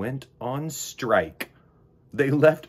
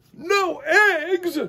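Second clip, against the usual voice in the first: much higher and louder disbelief; high, loud cry of dismay; crying out in shock; 6 dB louder than usual. Acted. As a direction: yelp it in outrage. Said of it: "cried Farmer Brown".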